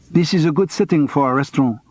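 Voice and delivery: put-on heavy French accent, cool and clipped by resentment. A narrator's reading. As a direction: speak English as a curt Frenchwoman with a heavy accent. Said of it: "Her English was heavily accented".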